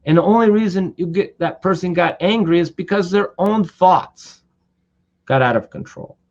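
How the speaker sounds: normal talking voice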